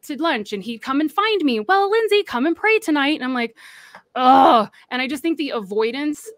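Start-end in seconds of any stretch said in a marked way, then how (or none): 1.6-3.2 higher, louder imitation of his summons; imitated coaxing invitation. Boss finding her at lunch invites her, coaxing. 4.2-4.7 loud retold "ugh" of dread; exasperated groan. Young woman dreading another prayer session, exasperated groan. Her retold reaction to his invitation.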